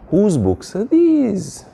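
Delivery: high-pitched, drawn-out mock asking; acted question. Acts out someone asking a question.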